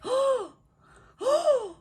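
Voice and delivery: high, loud shocked "oh, oh"; mock-shocked high exclamation. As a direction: gasp out the words in shock. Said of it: "She was like".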